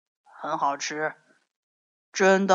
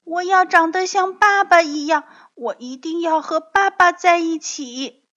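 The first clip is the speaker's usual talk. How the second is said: put-on high, loud, quick child voice; tearful child pleading; 10 semitones higher, 8 dB louder than usual. A narrator's reading. Young dinosaur begging to stay with father, high tearful plea. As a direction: plead like an upset little kid. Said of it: "扑簌簌流下了眼泪".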